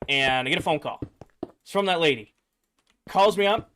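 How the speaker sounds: ordinary talk, nothing marked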